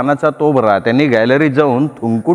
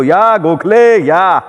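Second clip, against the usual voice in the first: calling out, long stretched Gokhale; shouted welcome; 6 semitones higher, 3 dB louder, much slower than usual. A narrator's reading. Narrator calling out to Gokhale so wife hears. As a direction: call the guest in loudly, drawn out. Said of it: "आम्ही एकदम ओरडलो".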